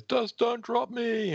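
high teasing voice; high girl voice. Acted. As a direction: voice Livewire up high. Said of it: "Livewire says".

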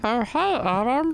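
high, squeaky bug greeting. Retold or imitated. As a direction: chirp it like a tiny critter. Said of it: "do your bug voice".

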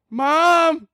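put-on high, yelling little kid calling for his mom; panicked kid. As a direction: cry for mom like a scared child. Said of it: "And Jimmy's like".

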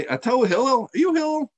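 high-pitched mock indignant question; incredulous challenge. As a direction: challenge him, voice leaping up. Annoyed man challenges Hillel.

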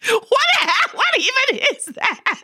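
very high squeal through laughter; real incredulous laughter; laughing disbelief. Real, not acted. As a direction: shriek it while cracking up. Cracking up at the exaggerated accent.

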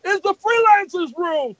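high, loud proclamation; proud announcement. Retold or imitated. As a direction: announce it like claiming territory. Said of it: "He said".

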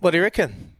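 ordinary delivery

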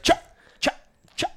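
voiceless, popped "chuck"; cluck imitation. Shown as a demonstration. Hunter showing how his early clucks sounded, sharp voiceless chucks. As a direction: imitate failed turkey clucks without a call. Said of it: "trying to cluck on it".

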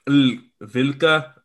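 Vilca said slowly, carefully Spanish; Spanish pronunciation. Shown as a demonstration. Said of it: "you gotta add a little more, like".

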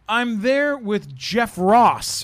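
loud, high, slowed right down; emphatic protest. Retold or imitated. Steven answering his jealous girlfriend, exasperated. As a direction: spell it out slowly and emphatically.

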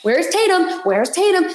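frustrated fan calling out; exasperated calling out. Retold or imitated. Yelling at TV during game.

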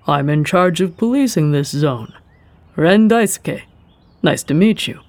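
composed official introducing himself; official male voice. A narrator's reading. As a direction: speak as a polished police chief.

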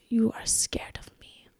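whispered mock Spanish accent; very soft, imitated Spanish-accented threat. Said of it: "Spanish accent".

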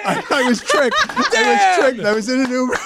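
high laughing protest. Real, not acted. Laughing at his own mistake.

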